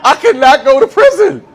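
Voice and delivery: normal talking voice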